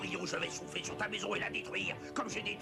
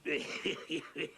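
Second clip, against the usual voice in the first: villain's satisfied snicker; gloating wolf chuckle; 2 semitones lower than usual. Acted. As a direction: chuckle greedily after the meal. Wolf gloats after eating.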